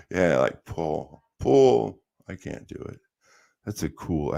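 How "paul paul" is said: put-on Liverpool accent. Mocking [name] calling [name], lightly Scouse.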